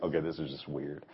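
muttered aside. Retold or imitated. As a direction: say it under your breath, uneasy. Blind man's inner thoughts.

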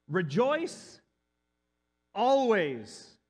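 slow, emphatic scripture read. Acted. Preacher reading Thessalonians to the congregation, proclaiming. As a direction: proclaim each phrase of the verse.

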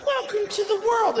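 high cooing mother voice; wife greeting newborn. Retold or imitated. Clueless wife to her new baby, high happy cooing. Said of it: "She's like".

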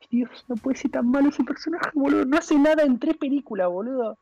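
pitched high in real exasperation; exasperated rant. Real, not acted. Friend ranting about Finn in a debate, exasperated. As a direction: complain in a high, incredulous voice. Heated argument over a bad character.

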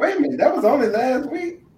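high, loud incredulous question; startled double-take, pitch shooting up; real surprise. Real, not acted. Host reacting to how recently school started, surprised.

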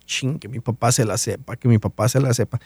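quietly wishing under the breath; hushed anxious wish. Retold or imitated. Daughter privately hoping dad knows the answers, hushed. Silent hope while questions start.

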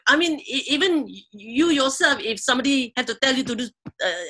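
ordinary talk, nothing marked

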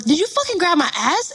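high, accusing demand; retold angry confrontation. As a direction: challenge him with the voice jumping up.

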